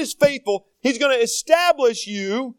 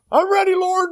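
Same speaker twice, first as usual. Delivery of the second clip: eager believer; 11 semitones higher, 4 dB louder than usual. Retold or imitated.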